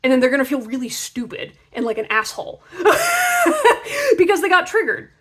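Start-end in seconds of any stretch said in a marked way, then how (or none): 2.7-5.1 high squealing laugh; real laughter. Real, not acted. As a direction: burst out laughing. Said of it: "laughing heard".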